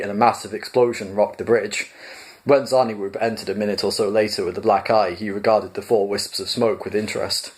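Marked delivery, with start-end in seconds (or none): none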